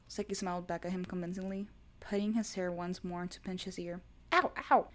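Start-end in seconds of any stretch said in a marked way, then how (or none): 4.3-4.9 high, loud pained whine; whining ow. A narrator's reading. Boyfriend pinching his ear, high pained whine. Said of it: "Kaido whined".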